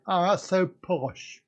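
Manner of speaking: ordinary delivery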